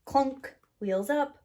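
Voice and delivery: usual speaking voice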